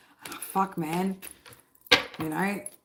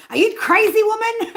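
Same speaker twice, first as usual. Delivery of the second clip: high, loud disbelieving cry; incredulous outburst; 13 semitones higher, 8 dB louder than usual. Real, not acted. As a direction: shout it in total disbelief.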